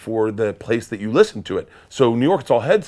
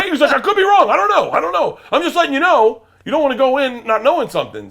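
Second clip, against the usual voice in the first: high, louder, laughing through it; laughing dad; 5 dB louder than usual. Retold or imitated. Father laughing as he warns his son, high and loud. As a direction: giggle out the warning way up high.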